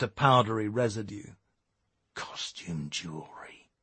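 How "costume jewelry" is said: slow, hushed, deep snarl; low snarl. A narrator's reading. Eric discovers the diamonds are fake, snarling low. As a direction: snarl it slowly under your breath. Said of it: "he snarled".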